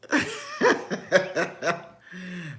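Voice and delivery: high burst of laughter; real laughter. Real, not acted. Amused while reading live chat.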